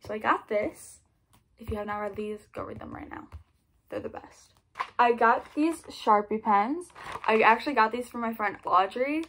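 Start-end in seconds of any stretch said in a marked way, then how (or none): 2.5-4.5 whispered urging; whispered recommendation, softer and lower. Real, not acted. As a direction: hush it, like a tip just for them. Book recommendation aside.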